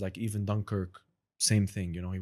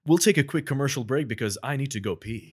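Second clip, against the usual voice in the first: projected, higher TV news anchor delivery; 4 semitones higher, 3 dB louder than usual. Acted. Guest improvises a bulletin at host's request, news anchor voice. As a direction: announce it like a live channel news bulletin. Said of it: "let's hear news anchor".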